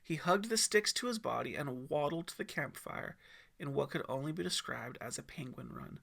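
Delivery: ordinary delivery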